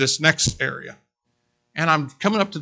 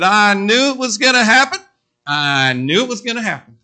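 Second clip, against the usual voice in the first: imitating bystander; 6 semitones higher, 6 dB louder than usual. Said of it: "He said".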